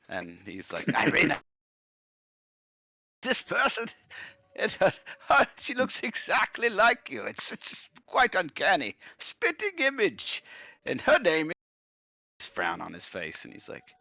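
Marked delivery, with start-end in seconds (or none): 0.9-12.5 put-on confused old man, hugely tickled by a lookalike; amazed senile grandfather.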